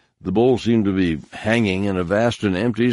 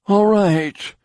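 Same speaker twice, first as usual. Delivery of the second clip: a much higher, louder gasp of surrender; a wounded man giving in with a gasp; 13 semitones higher, 4 dB louder than usual. Acted. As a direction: blurt it out, breaking under pain. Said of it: "Kunra gasped".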